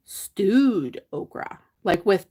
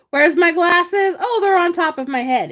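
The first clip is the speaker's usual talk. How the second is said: mock forgetful realization; 9 semitones higher, 5 dB louder than usual. Host acting out a forgetful moment with her glasses, mock surprise. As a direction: act out hunting for your glasses, then finding them. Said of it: "It's like".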